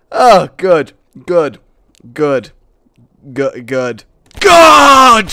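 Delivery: repeated loud bursts, last one shouted; loud exclaiming. Real, not acted. Loud exclamations while playing.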